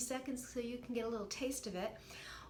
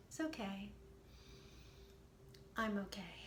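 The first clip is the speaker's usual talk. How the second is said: softer, gentle, slowly said reassurance; slow and soothing; 3 semitones lower, 8 dB quieter than usual. Shown as a demonstration. Coach showing the listener the soothing mantra, softer and slow. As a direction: say it slowly and feel it. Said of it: "just say it very slowly".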